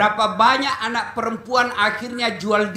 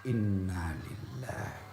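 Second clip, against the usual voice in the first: much lower, softer, slow recital; solemn recitation; 12 dB quieter, slower than usual. Shown as a demonstration. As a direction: murmur it low and slow. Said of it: "balik kanan". (bacaan rendah dan pelan)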